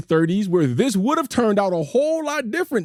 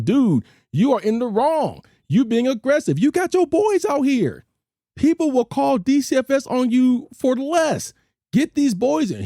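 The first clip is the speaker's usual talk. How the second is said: higher-pitched, insistent reasoning with someone; retold urgent warning; 7 semitones higher than usual. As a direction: replay trying to talk a man down, pitched up. Retells warning an aggressive father.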